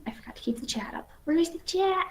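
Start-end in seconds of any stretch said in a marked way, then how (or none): 1.2-2.1 high singsong call; singsong nanny voice. Acted. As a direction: coax it back like a nanny. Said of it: "was that your nanny voice?".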